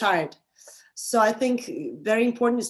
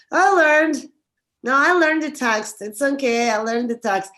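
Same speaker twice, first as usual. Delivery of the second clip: insisting to yourself, higher and louder at first; mock self-assurance; 4 semitones higher, 6 dB louder than usual. Actor fooling himself about knowing the text, mock insistence. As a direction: protest a little too much. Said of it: "don't lie to yourself".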